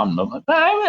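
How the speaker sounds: ordinary delivery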